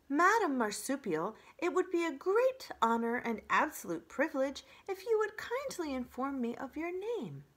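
high, effusively polite robot voice; overdone friendliness. Acted. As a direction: try very hard to sound friendly. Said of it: "in the friendliest voice she could muster".

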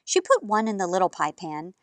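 plain reading voice

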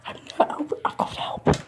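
usual speaking voice

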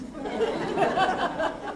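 high burst of laughter; laughing. Real, not acted. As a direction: laugh at your own joke. Laughing at own joke.

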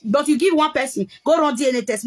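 normal talking voice

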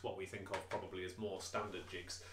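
usual speaking voice

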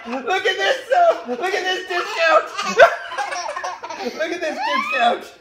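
very high squeals through laughter; hysterical excitement. Real, not acted. Parent showing off a discount, shrieking excitedly. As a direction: squeal in amazement, cracking up.

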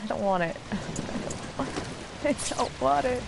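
usual speaking voice

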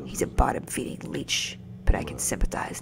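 whispering the game dialogue; whispered read. Acted. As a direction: read the lines in a hush. Reading game dialogue hushed.

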